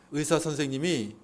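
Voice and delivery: ordinary talk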